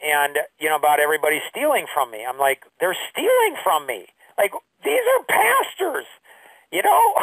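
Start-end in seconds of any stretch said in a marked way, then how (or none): 2.8-7.1 mock whining. Teen self complaining about thieves, high mock whine. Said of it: "I guess I was whining".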